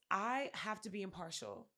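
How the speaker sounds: plain reading voice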